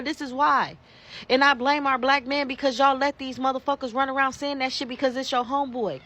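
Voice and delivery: ordinary talk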